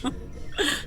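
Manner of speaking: embarrassed laugh. Real, not acted. Mum laughs at correction.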